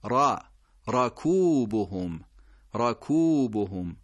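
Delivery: راکوبهم slow with open را; careful recitation; fatha first. Shown as a demonstration. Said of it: "فتحه داره".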